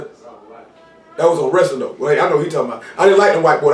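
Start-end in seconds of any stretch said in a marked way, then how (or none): none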